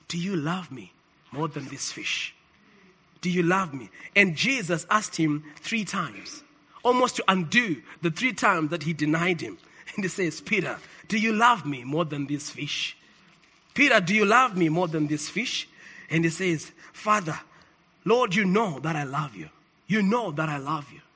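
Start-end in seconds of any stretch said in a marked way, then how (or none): 0.1-3.9 low, soft, gentle questioning; tender question. Retold or imitated. Acts Jesus gently questioning Peter. 10.4-15.7 low, soft, gentle questioning; tender question. Retold or imitated. Acts Jesus repeating his question. 16.9-21.0 low, soft, earnest reply; humble avowal. Retold or imitated. Acts Peter answering Jesus.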